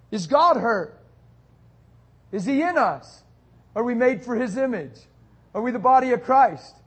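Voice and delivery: loud, high, punched-out rhetorical questions; full-force preaching. Real, not acted. Preacher challenging believers about being hurt, full-force preaching. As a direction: fire each question at the congregation at full volume.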